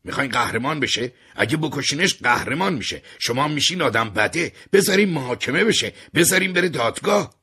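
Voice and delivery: high, loud, building shout; shouting at the police not to make him a hero. Acted. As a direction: argue hard with the police, voice climbing. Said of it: "داد زدم".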